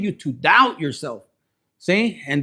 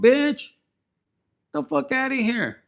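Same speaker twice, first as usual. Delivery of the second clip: much higher, scornful dismissal; indignant brush-off; 6 semitones higher than usual. Real, not acted. Reader dismissing a meddling commenter, high scornful brush-off. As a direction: shoo them off with scorn.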